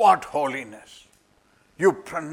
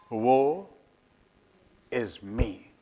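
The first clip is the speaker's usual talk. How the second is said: broken confession; 4 semitones lower, much slower than usual. Acted. Isaiah confessing before the Lord.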